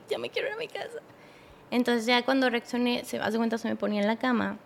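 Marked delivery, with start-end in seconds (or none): 0.1-1.0 very soft, high-pitched tearful plea; retold silent crying. Girlfriend crying silently wants to go home, faint plea. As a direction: act out a tiny tearful wish to leave. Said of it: "empecé a llorar, pero sin, sin sonido".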